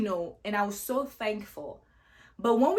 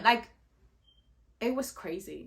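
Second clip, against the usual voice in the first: normal talking voice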